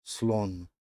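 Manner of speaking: سلون said as Russian "slon" in one clipped syllable; سلون with a clear falling tone; Russian word. Shown as a demonstration. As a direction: model سلون crisply for the class. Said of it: "مثل دوستان".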